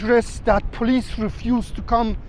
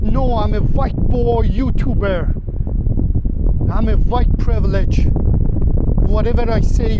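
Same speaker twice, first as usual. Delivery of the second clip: loud boast; 6 dB louder than usual. Retold or imitated. Telling the fisherman he is untouchable, loud boast. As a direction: proclaim it at full volume to the gunmen. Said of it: "But I said".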